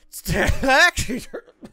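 bursting into a loud, high laugh; cracking up hard at the screen. Real, not acted. Gamer cracking up at the game, loud laugh.

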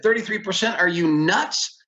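high-pitched, incredulous protest; acted outrage. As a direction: re-enact an indignant outburst at an absurd offer. Acted outrage at lender's rate.

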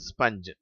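ஸ்பஞ்ச் with short a, not ஸ்பாஞ்ச்; correct pronunciation. Shown as a demonstration. Teacher correcting pupils' pronunciation of sponge. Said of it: "சரியான உச்சரிப்பு".

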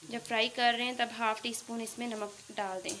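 usual speaking voice

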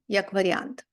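normal talking voice

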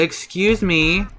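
ordinary delivery

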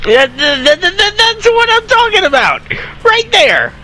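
excited cheer. Real, not acted. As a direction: cheer the win, stammering with excitement.

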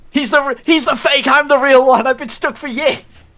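pleading high-pitched little voice; high squeaky character voice. Pretend. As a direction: squeak it out as the trapped drawing. Voicing the drawn character.

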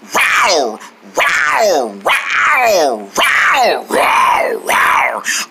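playful drawn-out rawrs; pretend tiger roar. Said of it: "my favorite cat is a tiger".

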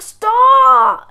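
sudden high, loud shout; angry shout. Retold or imitated. Sibling mid-argument over the calming song, shouting high and loud. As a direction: bark it to end the fight. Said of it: "And you're like".

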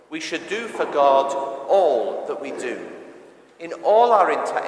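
ordinary talk

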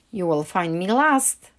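usual speaking voice

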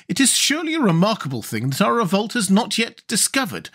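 put-on high, proud voice of a girl general; high-pitched young general, proud; General Ginger. A narrator's reading. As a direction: boast of your secret army.

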